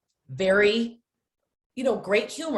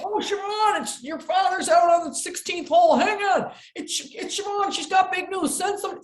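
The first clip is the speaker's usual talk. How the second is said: high, loud calling-out voice; golf course clerk calling out; 12 semitones higher, 4 dB louder than usual. Retold or imitated. Golf clerk yelling to the course that Siobhan has news, high and loud. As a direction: golf clerk shouting across the clubhouse. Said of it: "They're like".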